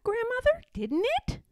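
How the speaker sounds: put-on high child-like questioning voice; eager little bear. A narrator's reading. Little bear asking Grandmother, high and eager. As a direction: ask high, lifting at the end.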